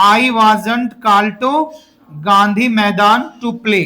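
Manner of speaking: very slow, word by word; slow model sentence. Shown as a demonstration. Teacher to his class, dictating the answer very slowly. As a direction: drag each word out for repeating. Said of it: "कहाँ पे मेरे भाई?".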